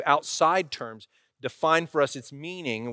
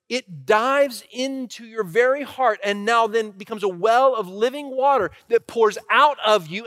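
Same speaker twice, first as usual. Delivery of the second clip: higher, louder, driving sermon delivery; impassioned preaching; 7 semitones higher, 5 dB louder than usual. Real, not acted. Pastor telling church about love poured into them, impassioned preaching. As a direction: build in force and drive each phrase home.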